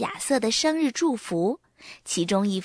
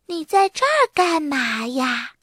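吃惊; 5 semitones higher than usual. A narrator's reading. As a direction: ask in astonishment. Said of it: "苏埃伦吃惊地说". (吃惊地拉高音调问)

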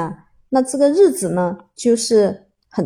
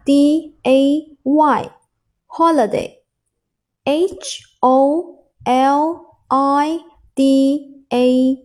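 letter by letter, very slowly; slow dictation of single letters; slow spelling; much slower than usual. Shown as a demonstration. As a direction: spell it out slowly, each letter held apart. Spelling the new word aloud.

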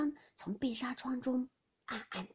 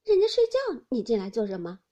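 coy scolding of an intruder; playfully indignant protest; smiling reproach; 8 dB louder than usual. A narrator's reading. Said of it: "一面笑向宝玉道".

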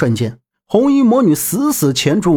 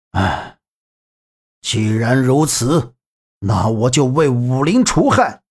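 resigned sigh; 3 semitones lower, slower than usual. A narrator's reading. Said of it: "叹了口气".

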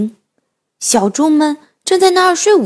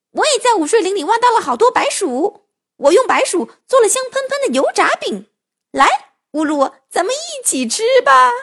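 high character voice; 8 semitones higher than usual. Acted. Beiluo character voice.

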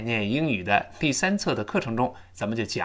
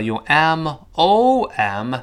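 ordinary talk

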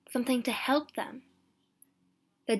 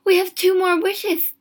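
high, loud, frantic hope; feverishly elated outburst; excited cry; 9 semitones higher, 12 dB louder than usual. A narrator's reading. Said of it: "she cried".

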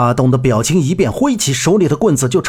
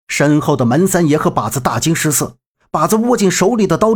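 usual speaking voice

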